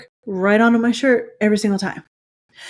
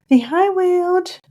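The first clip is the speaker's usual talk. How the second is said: high, slow cooing to a pet; 11 semitones higher, much slower than usual. Real, not acted. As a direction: coo sweetly at the cat. Talking to her cat.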